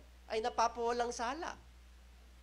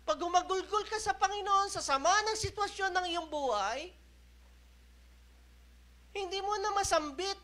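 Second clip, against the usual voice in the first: ordinary delivery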